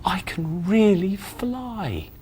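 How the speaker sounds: slow, soft, amazed; wonder. Acted. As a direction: breathe it in wonder. Pig amazed.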